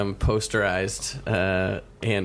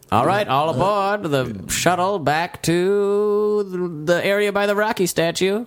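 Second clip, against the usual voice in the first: put-on high, drawn-out old-timey shuttle driver; slow, high old-timey conductor call; 7 semitones higher, slower than usual. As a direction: call everyone aboard in an old-fashioned announcer voice. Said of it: "Boo that old-timey shuttle voice".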